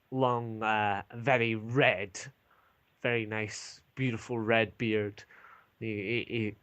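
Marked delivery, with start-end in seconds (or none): none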